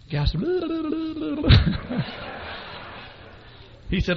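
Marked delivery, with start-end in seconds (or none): 0.5-3.0 high mock tongue-tied blubber; spluttered babble. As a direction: babble wordlessly, high-pitched. Retold guy stammers instead of answering.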